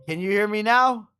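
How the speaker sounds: high, loud call into the mic; shouting to be heard. Real, not acted. Testing if friend hears him.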